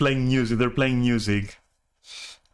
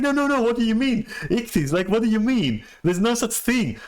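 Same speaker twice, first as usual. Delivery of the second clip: 1 high insistent denial; 8 semitones higher than usual. Retold or imitated. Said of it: "They go like".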